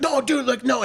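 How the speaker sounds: high-pitched placating voice; imitated frantic pleading. Friend pleading with angry man at doorway, imitated frantic pleading. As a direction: imitate a guy begging, voice jumped way up.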